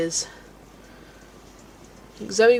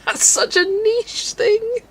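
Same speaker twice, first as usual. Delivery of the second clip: laughing; 13 semitones higher, 6 dB louder, much slower than usual. Real, not acted. Laughing at friend's [name] comparison.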